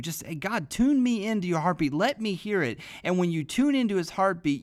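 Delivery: loud, high, rising sermon voice; full-force preaching. Acted. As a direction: preach it out to the congregation.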